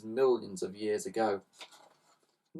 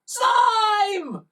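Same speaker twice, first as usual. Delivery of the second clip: high, loud call; shouting; 6 dB louder than usual. A narrator's reading. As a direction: yell the name up to the roof. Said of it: "the boy shouted again".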